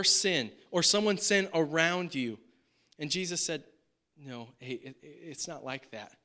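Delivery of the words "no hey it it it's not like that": soft, much quieter reassurance; gentle correction. Retold or imitated. Jesus gently correcting his disciples, soft and reassuring. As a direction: ease them off the wrong idea.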